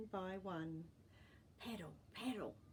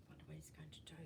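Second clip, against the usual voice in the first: hushed whisper to the listeners; storyteller whispering a suspenseful aside; 3 semitones lower, 14 dB quieter, much faster than usual. Real, not acted. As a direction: whisper it like a secret. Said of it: "aside to listeners".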